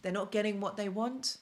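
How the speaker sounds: normal talking voice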